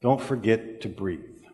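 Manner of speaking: soft, slow reminder; slow right down and speak softly; hushed lesson. Retold or imitated. Passing on one of the best lessons learned, hushed. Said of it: "sounded like this".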